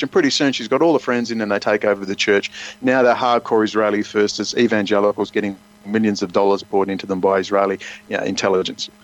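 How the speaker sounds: usual speaking voice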